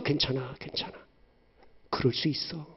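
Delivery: very soft, gentle reassurance; soft parent comforting a crying child; hushed comfort. Acted. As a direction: hold a child and calm them. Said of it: "자녀를 안아주면서".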